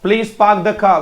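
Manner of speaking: पार्क as "paak" and car as "khaa", both R's silent; phonetic English pronunciation. Shown as a demonstration. Teacher shows pupils the phonetic sentence, silent R's. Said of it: "रह तो बोला ही नहीं जाता".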